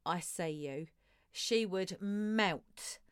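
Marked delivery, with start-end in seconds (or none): 0.0-0.9 low, soft, meaningful murmur; intimate line. Acted. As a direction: say it softly, straight to her. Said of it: "he looked at her and went".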